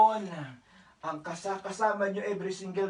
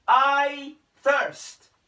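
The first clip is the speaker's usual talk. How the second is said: louder, higher I thirst, stretched on I; crying out; 5 semitones higher, 8 dB louder than usual. Retold or imitated. Preacher voicing Jesus crying from the cross, louder plea. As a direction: call out the thirst. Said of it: "sumigaw po si Jesus".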